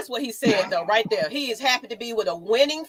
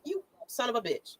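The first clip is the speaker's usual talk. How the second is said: a quiet, disbelieving swear at his own screen; muttered curse; 9 dB quieter than usual. Retold or imitated. Retelling his first reaction to the tweet, muttered under his breath. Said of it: "I was like".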